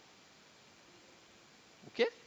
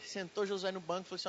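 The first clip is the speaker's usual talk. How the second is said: ordinary talk, nothing marked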